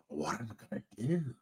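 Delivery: hushed, helpless question to oneself; quiet dismay. Retold or imitated. Stranded rider wondering at Poughkeepsie, quietly dismayed.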